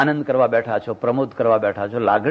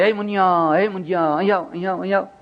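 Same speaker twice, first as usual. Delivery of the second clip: calling out; 5 semitones higher, faster than usual. Retold or imitated. Grandfather calling the boy.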